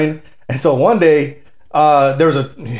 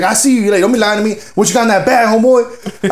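higher-pitched accusing grilling; acted interrogation; 7 semitones higher than usual. Dude accusing a tamale seller, confrontational. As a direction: press him for the truth.

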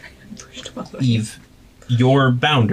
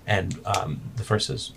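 usual speaking voice

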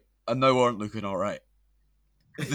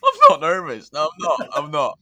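loud protesting denial; 4 semitones higher, 10 dB louder than usual. Pretend. Joking denial about nervousness.

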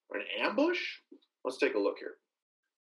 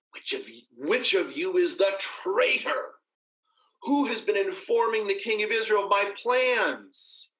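angry king demanding; 6 semitones higher than usual. A narrator's reading. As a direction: demand the traitor's name, barely holding his temper. Angry king demands of his officers.

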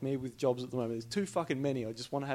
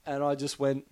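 normal talking voice

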